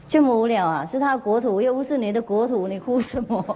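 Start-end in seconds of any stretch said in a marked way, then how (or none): none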